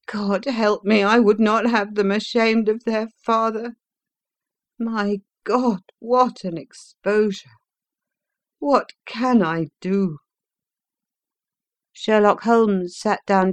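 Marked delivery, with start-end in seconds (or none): 0.1-10.2 anguished prisoner. A narrator's reading. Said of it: "groaned the prisoner".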